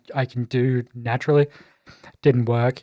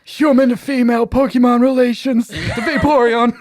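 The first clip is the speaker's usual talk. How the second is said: John's ranting voice; 10 semitones higher, 4 dB louder than usual. Retold or imitated. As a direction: launch into a parody of a random internet rant. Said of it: "That's what he would say".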